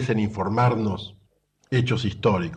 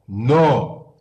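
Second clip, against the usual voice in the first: a loud, curt retold "no"; half annoyed, grumpy; 5 dB louder than usual. Tired fishermen answering the stranger on shore, half annoyed. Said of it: "le contestan medio entre enojados y, y embroncados".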